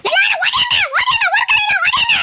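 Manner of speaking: high, crying shout to everyone around; calling out for help. Acted. Woman crying out to neighbours to come, high tearful shout. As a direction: yell for people to come running.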